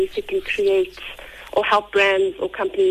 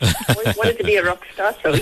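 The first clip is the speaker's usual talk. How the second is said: laughing. Real, not acted. Jokes about her husky voice.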